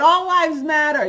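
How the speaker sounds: loud, high, defiant declaration; defiant shout. Real, not acted. Woman confronting a family on the beach, loud defiant shout. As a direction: throw the slogan at them loudly. Says it to the parents.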